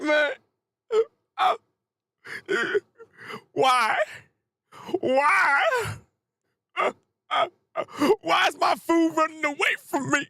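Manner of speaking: high, wailing hammed-up sob voice; mock crying monster. Devil sobbing that his food runs away, high mock wail. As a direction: whimper it like a starving beast losing its meal. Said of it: "he's literally crying right now".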